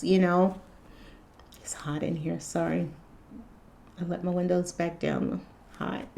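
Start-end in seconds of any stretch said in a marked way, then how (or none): 1.6-6.1 quiet aside. Real, not acted. Aside about the heat.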